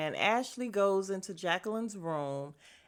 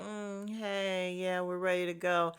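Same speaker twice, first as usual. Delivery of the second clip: soft, half-hearted hello; unenthused greeting; 2 semitones lower, 6 dB quieter than usual. Retold or imitated. Everybody greeting the unwelcome friend, soft and unenthused. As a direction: imitate a group that isn't feeling the newcomer. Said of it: "they not feeling Mia at all".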